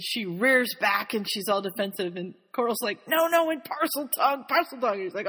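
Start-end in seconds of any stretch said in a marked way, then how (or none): 3.1-5.0 high, insistent prompting; acted urgent correction. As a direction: hastily correct him, pitch raised. Coral hastily corrects Harry.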